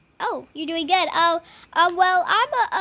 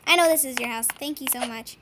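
ordinary talk

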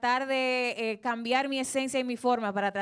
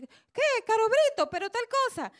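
mocking gossip; 9 semitones higher than usual. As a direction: gossip voice, pitched higher. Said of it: "mucha gente decía". (imitando a la gente chismosa, en tono más agudo)

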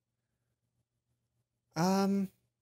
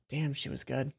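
rueful low aside; 6 semitones lower, 7 dB quieter than usual. Acted. Narrator admitting Mai outplayed her, low and grudging. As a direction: lower and softer, grudging admiration.

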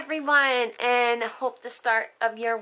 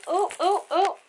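ordinary talk

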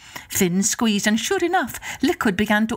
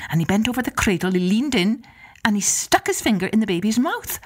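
ordinary delivery

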